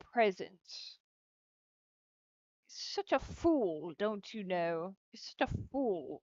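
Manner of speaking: mock-exasperated Brit, pitch leaping up; British insult rant. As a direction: scold theatrically in a put-on British accent. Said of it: "my British insults".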